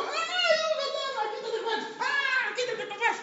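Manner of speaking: very high, loud comic shrieks; mock panicked screaming. Disciples circling in the boat fleeing a ghost, panicked screaming. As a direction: scream and scramble in hammed-up panic. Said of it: "Se los imagina gritando".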